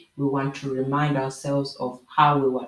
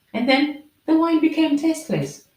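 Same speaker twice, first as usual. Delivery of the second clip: high and loud punchline; dramatic punchline; 13 semitones higher, 5 dB louder than usual. Real, not acted. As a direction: land the twist with drama. Punchline of the proverb.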